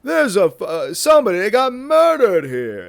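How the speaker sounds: high, loud, panicked found-footage gasp; found footage horror. Pretend. A guy in his own found-footage horror clip, panicked and loud. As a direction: play the scared guy finding a body on tape. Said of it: "found footage thing where it's me going".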